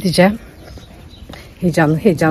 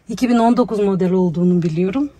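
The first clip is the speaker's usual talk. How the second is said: ordinary delivery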